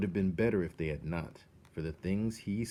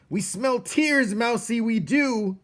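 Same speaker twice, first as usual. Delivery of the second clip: high, loud slow taunting rat voice; gloating rats; 11 dB louder than usual. Acted. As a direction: savour every word of the taunt.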